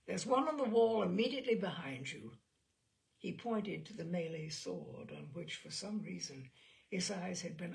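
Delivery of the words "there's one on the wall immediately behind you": irritably. Acted. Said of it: "her husband replied irritably".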